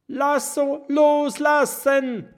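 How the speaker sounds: hoch, laut und langsam gerufen; lauter Ruf. Acted. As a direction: jede Silbe hinausrufen. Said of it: "ruft Kokosnuss". (Kokosnuss ruft Oskar in der Luft zu, laut und langsam)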